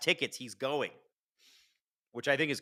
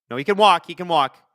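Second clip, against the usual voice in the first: relieved exclaim; 5 semitones higher, 8 dB louder than usual. Real, not acted. Relief as injured player walks.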